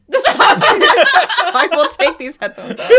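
high, loud laughing plea; begging through laughter. Real, not acted. Laughing at bird sounds replayed.

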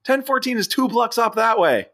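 high, projected voice, as if over rotor noise; mock calling out. John McClane corrects the helicopter's address, high mock shout. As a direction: call directions up to a helicopter, hammed-up.

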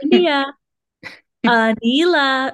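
slow announcer call; pa page. Pretend. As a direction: drag the name out over a PA. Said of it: "being announced like that".

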